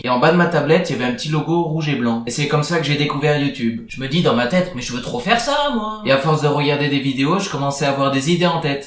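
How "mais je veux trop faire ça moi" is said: put-on higher eager child voice; excited kid. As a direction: gush like a thrilled kid. Child self quoted.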